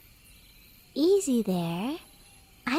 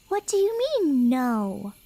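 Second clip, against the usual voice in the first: indignant surprise. Acted. Traveler refuses her company.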